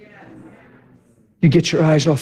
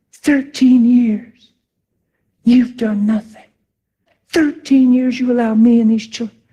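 rant; 9 semitones higher, 3 dB louder than usual. Retold or imitated. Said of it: "Remember that rant?".

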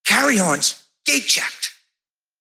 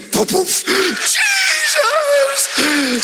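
ecstatic shout; much slower than usual. Retold or imitated. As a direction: shout Jesus in rapture. Running to him, rejoicing.

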